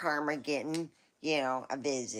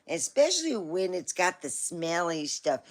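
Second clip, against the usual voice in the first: ordinary talk, nothing marked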